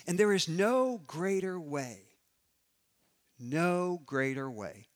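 a preacher lifting the congregation; preaching at full force. Acted. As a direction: swell up and proclaim it. Rising to a loud emphatic point.

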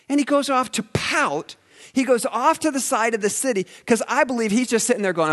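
high, loud preacher voice; fired-up sermon delivery; preaching at full force. Acted. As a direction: lift the room, full pulpit energy.

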